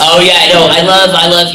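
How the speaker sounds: ordinary delivery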